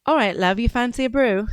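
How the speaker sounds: playful northern English accent; northern British accent. Shown as a demonstration. Showing off for the chat, northern British accent. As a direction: put on northern English for a friendly offer. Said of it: "so a northern accent".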